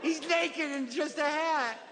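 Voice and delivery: put-on high, singsong little-kid voice; child retelling a storybook. Kid sharing the favorite book mom got, childlike storytelling.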